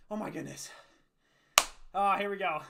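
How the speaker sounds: winded after push-ups; panting between words; out of breath. Real, not acted. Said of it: "Gotta catch my breath".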